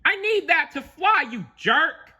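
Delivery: high, loud squawky bird voice; indignant seagull cry. Acted. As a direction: protest loudly in a high-pitched character voice. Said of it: "the seagull cried".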